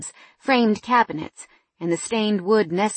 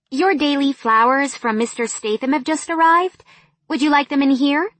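put-on higher, brighter young assistant; 7 semitones higher, 5 dB louder than usual. A narrator's reading. Assistant Rita voiced in story.

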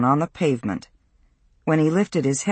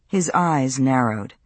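plain reading voice

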